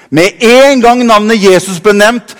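ordinary talk, nothing marked

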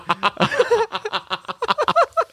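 shrieking high laughter. Real, not acted. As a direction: crack up hard. Laughing at own joke.

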